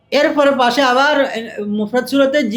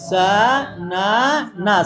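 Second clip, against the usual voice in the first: সা না না stretched very slowly, each syllable long-drawn; dragged-out reading; 3 semitones lower, much slower than usual. Retold or imitated. Teacher mimicking students who drag the reading, very slow. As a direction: drawl সা না না like a beginner pulling every syllable. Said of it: "ছেলেরা একটু টান দিয়ে পড়বে প্রথমে".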